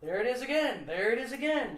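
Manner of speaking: pouncing on a find; acted recognition. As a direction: call it out high and loud each time. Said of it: "I'd be like".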